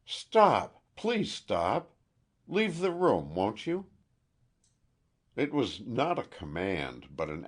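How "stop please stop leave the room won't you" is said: high, loud, desperate outburst; frantic plea to the landlady to leave; nervous fury. A narrator's reading. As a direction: burst out, begging them to stop. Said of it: "with a burst of nervous fury".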